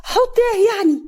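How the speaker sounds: high, loud protest; acted wailing self-defence; indignant denial. A narrator's reading. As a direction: protest innocence at the top of the voice. Accused of spoiling the cake.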